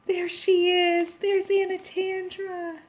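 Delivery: slow, high, delighted; delighted high exclaim. Real, not acted. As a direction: coo over her, drawn out. Seeing the heroine.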